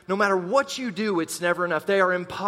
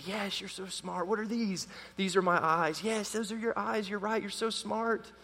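put-on hushed, softer praise for a toddler; soft baby praise; 8 dB quieter than usual. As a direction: coo gently over the child. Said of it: "You say".

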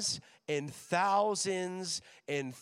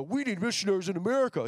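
lightly voiced, fast, earnest patriot; a quick hint of a fired-up American; gung-ho American; 2 semitones higher, 4 dB louder, faster than usual. American student claiming America, lightly voiced eager patriot. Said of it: "the guy who's like".

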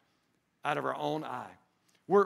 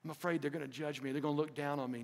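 afraid of judgment; 5 semitones lower, 10 dB quieter, faster than usual. Retold or imitated. Voicing fearful church members.

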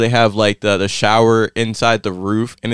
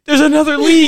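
high, laughing imitation of a panicky sister; mocking the sister; 4 dB louder than usual. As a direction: squeak it out like the flustered sister while laughing. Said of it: "and your sister goes".

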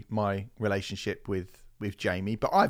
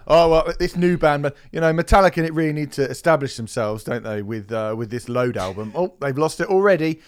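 mocking know-it-all fans; 3 semitones higher, 9 dB louder than usual. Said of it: "people are gonna".

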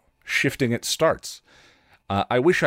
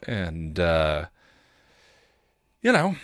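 ordinary talk

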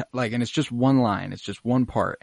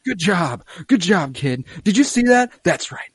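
louder, higher cheer; proud excitement; 9 semitones higher, 7 dB louder than usual. Retold or imitated. Din praising Grogu after the catch: excited, proud cheer. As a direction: cheer the kid on like a proud dad.

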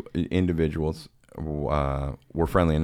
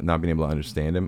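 ordinary talk, nothing marked

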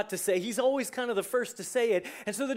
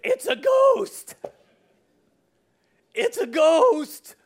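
high, loud, drawn-out panic; acted terrified shriek, stretched out slowly; frightened cry; 13 semitones higher, 10 dB louder, much slower than usual. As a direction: cry out in hammed-up terror. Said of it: "in their fear they cried out".

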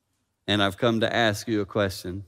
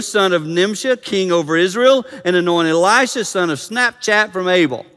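loud, raised proclamation of a command; declaimed scripture reading; 8 semitones higher, 9 dB louder than usual. Real, not acted. God commanding Elijah in verse fifteen, declaimed scripture reading. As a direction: proclaim it from the pulpit, high and loud.

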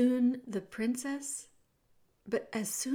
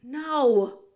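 shouted; 4 semitones higher, 7 dB louder than usual. Acted. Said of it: "shouted Roscuro".

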